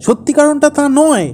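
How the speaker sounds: loud, high-pitched indignation; angry outburst. Acted. Husband hears wife calling his story wrong, angry outburst. Husband erupts over perceived criticism.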